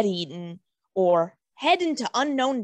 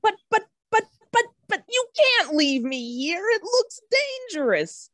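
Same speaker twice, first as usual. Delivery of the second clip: panicky stammer; 10 semitones higher than usual. Acted. Shrub begs not to be left.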